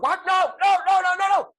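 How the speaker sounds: very high, loud frantic denial; hammed-up panic. Joker acted as his power dies, hammed-up panicked cries. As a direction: shriek it like a thwarted villain.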